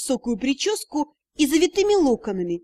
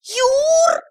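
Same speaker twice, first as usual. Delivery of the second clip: very high, loud scream for help; desperate yell; 13 semitones higher, 5 dB louder than usual. A narrator's reading. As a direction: wail the name out loud. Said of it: "я издала вопль". (отчаянный высокий вопль)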